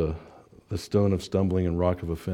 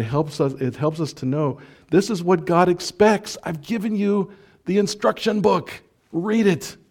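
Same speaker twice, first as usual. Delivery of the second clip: much higher, urgent preacher cry; impassioned preaching; 9 semitones higher than usual. Real, not acted. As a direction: press the command on them. Preaching climax.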